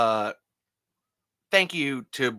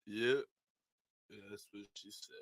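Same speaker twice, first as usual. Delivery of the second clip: Stallone impression; 3 semitones lower, 13 dB quieter than usual. Stallone phoning the podcast hosts, in a lower Stallone voice. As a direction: do Sylvester Stallone confessing to the hosts. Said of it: "I was trying to do Stallone".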